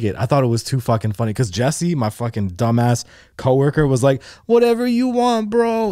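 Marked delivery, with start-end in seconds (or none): 4.5-5.9 high-pitched whimpering plea, imitated; hammed-up tearful begging; mock crying. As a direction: imitate a sobbing coworker giving in. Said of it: "He starts crying".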